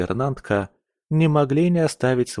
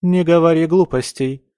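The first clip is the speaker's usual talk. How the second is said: firm, raised-pitch motherly rebuke; moderately stern, pitched-up correction; mild reprimand; 5 semitones higher, 4 dB louder than usual. A narrator's reading. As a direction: check her nonsense firmly. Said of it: "одёрнула дочь баронесса".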